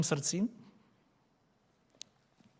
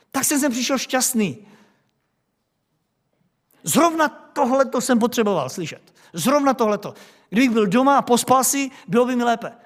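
high, sulky grumbling; high, sarcastic, put-upon whine; whiny complaining man; 6 semitones higher, 5 dB louder than usual. Acted. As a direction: sulk like a churchgoer whose morning was spoiled. Continues the annoyed man's complaint.

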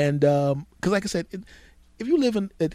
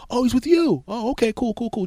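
surprised, agreeable; 10 semitones higher than usual. Retold or imitated. Mechanic's sudden friendliness, acted.

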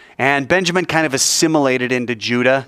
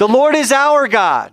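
high, drawn-out ringing call; with gusto; 11 semitones higher, 4 dB louder than usual. Shown as a demonstration. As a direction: lead the congregation in a full-voiced prayer call. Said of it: "say it with some gusto".

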